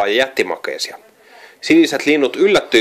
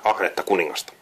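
usual speaking voice

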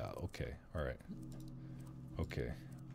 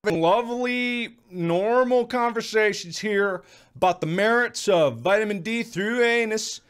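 mock outrage; 11 semitones higher, 8 dB louder than usual. Mock outrage at gross talk.

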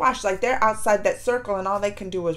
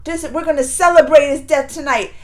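loud, punchy mock proclamation; retold yelling; 2 semitones higher, 8 dB louder than usual. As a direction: imitate a mean prince yelling to his men. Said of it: "that's what he's yelling out to them".

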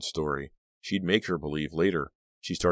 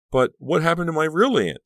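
much higher, loud demand; 12 semitones higher, 7 dB louder than usual. A narrator's reading. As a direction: insist on an answer. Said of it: "Danielle demanded".

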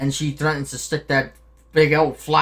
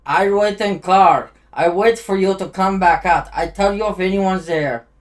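put-on loud, raised Eastern European accent; Nico Bellic accent; 4 semitones higher, 6 dB louder than usual. Player voicing game lines to his friend, Eastern European accent. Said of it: "I sound like Nico".

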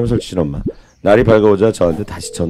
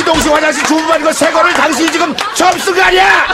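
high, loud, disbelieving rant; can you believe this guy, mock outrage; incredulous scolding; 3 dB louder than usual. Toilet company man lecturing the customer, incredulous and loud. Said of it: "어이없다는 듯이".

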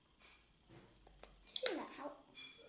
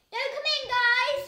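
loud high shout to family; yelling across the room; 11 semitones higher, 19 dB louder, slower than usual. Real, not acted. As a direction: yell it through the door.

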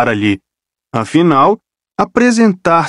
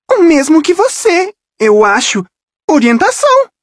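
voz de garota. Acted. Rachel responde seca.

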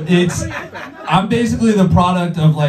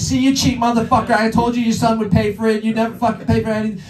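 higher, fast mocking taunt; gloating mom; 5 semitones higher, faster than usual. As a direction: rub it in the husband's face. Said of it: "waving the money in his face".

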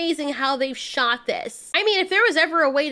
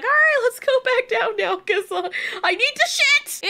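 recapper voices Becky wanting down, high put-on voice; mock Becky; 8 semitones higher than usual. Recapper mimics friend urging a climb down, mock high voice. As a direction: imitate the friend in a raised, put-on pitch. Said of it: "but she's like".

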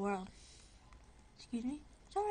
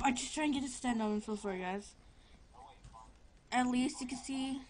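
ordinary talk

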